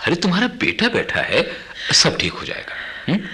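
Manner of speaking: laughing it off for mother; light laugh to hide worry; reassuring laugh. Acted. Son brushing off his mother's worry, with a laugh.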